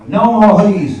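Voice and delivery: usual speaking voice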